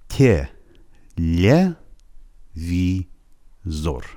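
slow, separated tye, lye, vii-zor with long gaps; syllable-by-syllable pronunciation. Shown as a demonstration. Teacher repeating for learners, slow syllables. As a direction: spell out the sounds piece by piece, drawn out.

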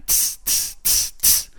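rhythmic voiceless ts, ts, ts; dancers' ts-ts beat. Retold or imitated. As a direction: imitate a dance beat with soft hissed ts sounds.

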